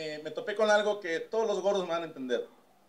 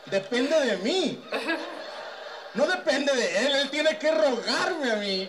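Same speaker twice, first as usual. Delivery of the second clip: slow, higher and louder, laughing all through; laughing gloat; 8 semitones higher, 3 dB louder, slower than usual. Real, not acted. Comedian to crowd, gloating with laughter. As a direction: savor each word while cracking up.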